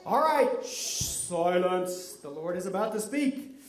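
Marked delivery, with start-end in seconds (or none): 0.6-2.1 long shush, then slow "silence"; slow, stagey call for silence; solemn hushing. Pretend. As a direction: hush the crowd with mock solemnity. Hushing audience before the Lord speaks.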